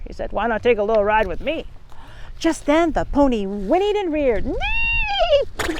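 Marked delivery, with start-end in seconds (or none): 0.3-1.7 a fast, sly lure; sweet-talking wolf coaxing her along. Acted. Wolf tempting the girl to ride with him, quick and sly. Wolf luring Little Red. 4.5-5.8 high whinnying neigh; pony whinny. Acted. Startled pony rearing at the wolf, high whinny.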